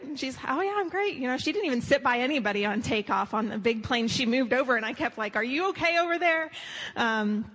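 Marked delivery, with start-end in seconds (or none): 0.5-1.1 much higher, bright breezy reassurance; chipper Katie. Retold or imitated. Katie answering her worried friend on the plane, chirpy. 5.4-6.5 much higher, anxious check-in; concerned calling. Retold or imitated. Friend checking on Katie across the plane, worried.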